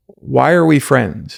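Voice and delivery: slow, weighty, each word given space; slow sincere question. Shown as a demonstration. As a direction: ask it slowly, like it really matters. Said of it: "ask them this very vulnerable question".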